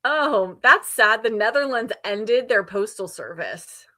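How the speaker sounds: ordinary delivery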